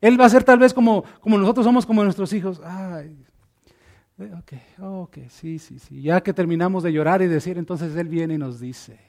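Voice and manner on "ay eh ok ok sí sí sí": much softer, lower, sniffly yeses; crying child calming. Pretend. Child giving in to the father after tears, soft. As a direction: settle down like a child done crying.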